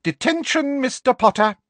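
very high, loud crow of victory; gloating high-pitched pronouncement; Professor Umbridge, triumphant. A narrator's reading. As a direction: sing out the punishment in triumph. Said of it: "said Professor Umbridge triumphantly".

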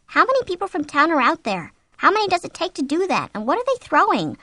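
high-pitched, bewildered inner voice; anxious wondering. A narrator's reading. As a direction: puzzle it out in alarm. Frightened wife's racing thoughts.

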